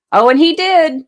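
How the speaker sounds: loud, high disbelieving exclamation; loud excited burst. Real, not acted. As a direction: blurt it out with a high, loud laugh in the voice. Reacting to Brennan in court.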